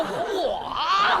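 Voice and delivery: high, drawn-out mock gasp of surprise; startled exclamation. Father first seeing his newborn, high startled exclamation. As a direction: exclaim high at a shocking sight.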